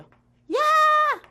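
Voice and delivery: high, loud, drawn-out cry; playful long high shout; excited yell. Acted. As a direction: yell it out like a crowd rushing over. Voices electrons swarming to one side.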